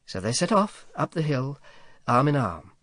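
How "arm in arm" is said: usual speaking voice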